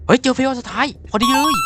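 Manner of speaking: high, excited cry of discovery; excited outburst. Real, not acted. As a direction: blurt it out high, thrilled at the find. Sudden find in horror game. (ร้องเสียงสูงอย่างตื่นเต้น)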